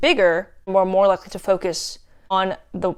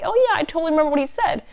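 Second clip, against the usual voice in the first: much higher, rapid mock recollection; imitated audience member; 9 semitones higher, much faster than usual. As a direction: play a listener suddenly remembering, high and quick. Voices audience having a realization.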